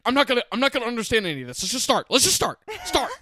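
high, loud, rapid protest; mock exasperated girlfriend. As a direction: imitate impatient rage, hammed up. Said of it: "she gets mad reading it".

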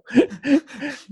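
bright high burst of laughter; real laugh up high. Real, not acted. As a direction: laugh at your own joke. Laughs at own retold joke.

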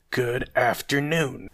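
slow, drawn-out farewell; stubborn, weighty dismissal; dismissive. Acted. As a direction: stretch it out with finality. Scrooge dismisses him once more.

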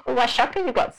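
high-pitched imitated woman's voice; nosy masseuse. Masseuse looking in his shopping, high mimicked voice. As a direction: mimic her prying question up high. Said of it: "she goes".

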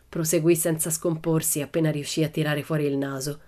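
ordinary delivery, nothing marked

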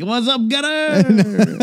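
high, slow mock greeting; mock old-timey fan. Fan greeting the Gunner in a slow mock voice. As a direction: call out to the Gunner like a buddy.

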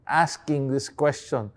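ordinary delivery

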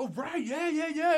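high-pitched mock cheer from the guys; hyped-up cheering. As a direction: imitate buddies celebrating your return. Friends greet him with hugs, high fives.

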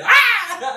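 high, loud imitated shriek; mock scream. Co-host acts Homer seeing "Diet", high mock scream. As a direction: scream like a startled cartoon Homer. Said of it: "he screams again".

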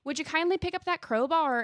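badly faked Irish lilt, politely coaxing; Atlas. Kind Irishman asking the player for help, bad Irish accent. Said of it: "that's the worst Atlas impression ever".

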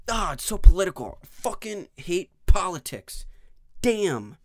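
shouting in disgust at politics; a real angry rant, loud; loud frustrated outburst. Real, not acted. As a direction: blow up about politics. Ranting about politics.

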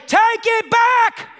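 slow, high, laughing call; half-laughing heckle from the pews; laughing mock objection. Pastor imagining objecting at a wedding, laughing. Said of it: "I wanna stand up and say".

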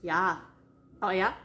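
"Yeah" with a long, drawn-out A; dragged-out A's. Shown as a demonstration. Showing their own home accent, drawn-out A in "yeah". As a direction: stretch the A in "yeah". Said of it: "we drag out our A's".

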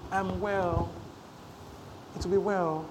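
soft, meek imitation; mock a feeble, gentle confession; too gentle. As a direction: say it small and softly. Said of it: "you are too gentle".

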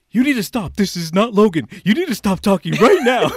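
high, loud scolding yell; freaking out. Retold or imitated. Woman ordering the man on the phone to stop, freaking out. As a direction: imitate an alarmed, furious telling-off. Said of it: "freaking out".

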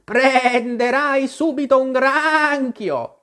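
high, slow warning with stretched vowels; scolding sheep. Acted. The sheep warns Alice she will catch a crab, high drawn-out scolding. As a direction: cry a warning as a bossy sheep.

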